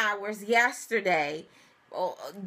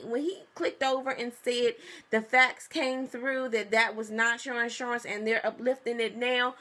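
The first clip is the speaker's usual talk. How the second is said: ordinary delivery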